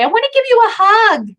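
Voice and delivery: put-on high, loud little-kid squeal; excited seven-year-old. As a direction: pipe up like a child running in for a hug. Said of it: "My seven-year-old run in to say".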